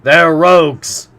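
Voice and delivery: enraged harpooner shout; furious. A narrator's reading. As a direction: spit the insult out. Ned furious at captors.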